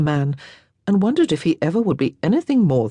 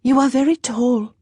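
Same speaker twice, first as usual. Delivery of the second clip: put-on light, higher girlish voice; a lightly voiced young lady, wistful; young Frenchwoman, a bit higher and brighter; young French girl; 8 semitones higher than usual. A narrator's reading. Girl's voice held.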